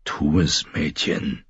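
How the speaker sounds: low, quiet rough soldier; gruff Hound. A narrator's reading. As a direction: push her on, grim. The Hound urges Sansa. (tiefer, leiser, rauer Krieger)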